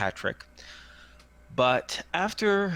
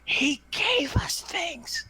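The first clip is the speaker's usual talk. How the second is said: put-on slow, high kid's whisper; stage whisper; much slower than usual. Little girl confiding about the man, slow stage whisper. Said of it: "It's like a stage whisper".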